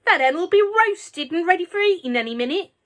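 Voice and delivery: put-on high, loud old woman's jeer; shouted. A narrator's reading. Grandma shouting gleefully from the roof. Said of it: "Grandma shouted".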